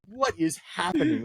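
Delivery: drawn-out, high-pitched disbelief; mock bafflement. Al watching the odd exchange, mock bafflement. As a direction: retell a friend's bewilderment, slowly and high. Al's baffled reaction, acted in retelling.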